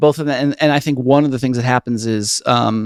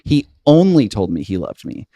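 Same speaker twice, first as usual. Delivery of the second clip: "only" leaned on, rest falls away; stress on ONLY. Shown as a demonstration. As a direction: hit the word only. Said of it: "put the emphasis on every single line".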